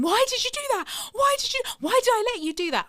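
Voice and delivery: high, fast, panicked questions; hysterical pleading. Retold or imitated. Mother pleading with her daughter after the haircut, high and frantic. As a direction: demand why in a frantic rush. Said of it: "I kept saying".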